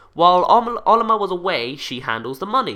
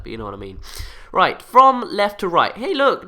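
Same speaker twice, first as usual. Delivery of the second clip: ordinary talk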